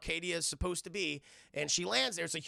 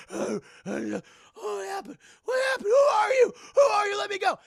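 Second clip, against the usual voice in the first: much higher, louder panicked shouting; panicked captive; 12 dB louder than usual. Acted. Tied-up Claire panicking at Alice, high frantic shouts. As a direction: yell like you woke up tied to a stranger.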